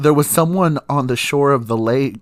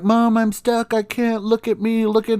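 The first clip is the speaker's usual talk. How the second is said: put-on much higher, whining kid's protest; whiny pleading; 9 semitones higher than usual. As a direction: plead like a stuck child. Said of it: "And I'm like".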